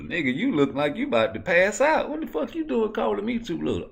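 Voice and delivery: high-pitched indignant comeback; put-on high protesting voice; indignant retort. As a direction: fire back, voice jumping up high. Acted face-off retort.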